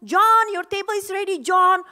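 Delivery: loud call across the lounge. Retold or imitated. Hostess calls a guest in the lounge, loud call. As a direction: call the name over the crowd. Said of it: "Jadi saya teriak".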